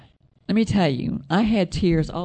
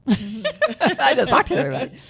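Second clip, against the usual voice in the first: laughing; 9 semitones higher, 4 dB louder than usual. Real, not acted. Laughs at herself.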